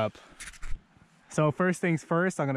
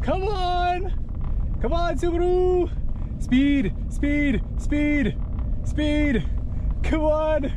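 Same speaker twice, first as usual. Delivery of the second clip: high, urgent cheering; will the car through the snow; excited urging; 13 semitones higher, much slower than usual. Real, not acted.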